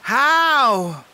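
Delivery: a high, eager little bear's how; a bouncing, hopeful cub. A narrator's reading. Little Bear asks Crow, a high, eager how. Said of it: "asked Little Bear eagerly".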